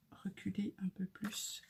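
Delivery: whispered to herself, under her breath. Real, not acted. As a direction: breathe the words out without voice. Talking to herself while gluing. (chuchoté pour soi)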